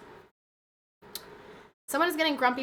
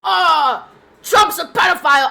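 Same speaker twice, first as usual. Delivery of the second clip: mock shrill, louder voice of critics; mocking quote; 5 semitones higher, 10 dB louder than usual. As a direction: parody the critics, louder and higher. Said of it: "when I see people being like".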